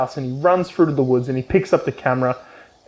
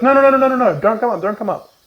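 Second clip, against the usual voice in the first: mock panic; 8 semitones higher, faster than usual. [name] panics, stops him.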